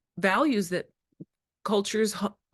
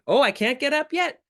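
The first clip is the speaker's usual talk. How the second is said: playful refusal; 9 semitones higher, 4 dB louder than usual. Pretend.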